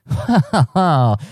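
high-pitched laugh; real laugh. Real, not acted. Asking himself the immediate move, laughing. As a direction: laugh at your own predicament. Laughs at his own dilemma.

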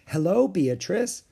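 high, slow, singsong hello; kindly librarian's lilt; friendly greeting. Acted. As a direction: greet a child sweetly.

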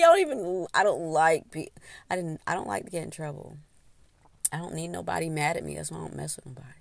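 normal talking voice

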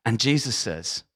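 ordinary talk

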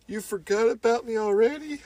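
mock hurt. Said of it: "you'd be like".

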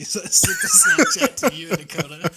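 quick, loud, giggly telling; laughing. Real, not acted. Hunter telling friend about tiny clover patch, laughing. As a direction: talk through bursts of laughter.

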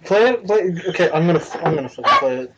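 normal talking voice

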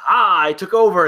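mock triumph. Kid's dad retelling the Loki variants' takeover, mock triumphant. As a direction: crow it like a trickster taking charge. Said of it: "one was like".

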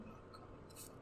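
voiceless, very soft aside to himself; retold inner groan, whispered under the breath; muttered dismay. Reacting privately to girls' compliments, whispered dismay.

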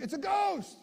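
high, startled cry of fright; mock fright. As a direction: squeal it like scared fishermen. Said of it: "They think".

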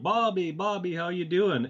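high, hammed-up call through the wall; mock screaming out. Friend yelling through the office wall, mock hollering. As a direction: imitate a friend hollering a greeting. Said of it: "he'd start screaming out".

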